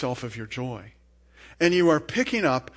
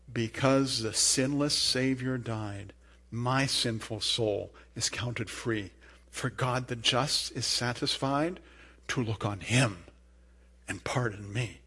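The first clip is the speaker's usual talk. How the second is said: slow, soft, lowered reverent reading; hushed devotional verse reading; reverent recitation; 3 semitones lower, 6 dB quieter, slower than usual. Acted. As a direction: recite it slowly and quietly, sinking to a hush. Recites closing lines of quote.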